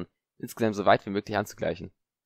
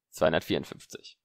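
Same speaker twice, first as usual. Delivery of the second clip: plain reading voice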